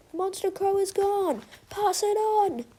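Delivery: high, carrying announcement call; high-pitched town-crier style call. A narrator's reading. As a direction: call the news across the park in a high voice. Pigeon calls out to other animals.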